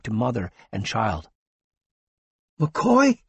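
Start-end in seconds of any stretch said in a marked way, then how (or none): 2.6-3.2 panted. A narrator's reading. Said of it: "panted the ace".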